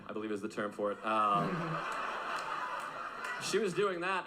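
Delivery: usual speaking voice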